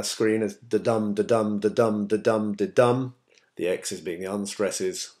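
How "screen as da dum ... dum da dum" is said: da-dum da-dum, the dum hit a bit harder; each da-dum said as light then stressed beat; iambic beat. Shown as a demonstration. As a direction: tap out the iambic rhythm da-dum. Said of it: "annotated it there ... as da-dum".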